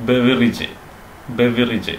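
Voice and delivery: ബെവറേജ് as "BEV-uh-rij"; short "bev" and "-rij" in ബെവറേജ്; correct pronunciation. Shown as a demonstration. Teacher modelling for learners, ബെവറേജ് as "BEV-uh-rij". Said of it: "പറയരുത്".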